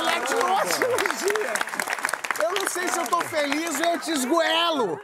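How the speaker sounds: high, incredulous exclaim; mock outrage. Real, not acted. Host reacting to the postponed surgery, high mock outrage. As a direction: play up the shock. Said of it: "Olha que loucura!".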